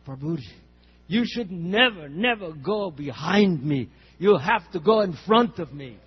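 put-on loud, slow, insistent Russian accent. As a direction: plead it slowly in a Russian accent. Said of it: "I'll imitate a little bit to him".

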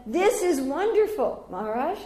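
high, slow gushing praise; sweetly impressed; delighted. Retold or imitated. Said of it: "And I said".

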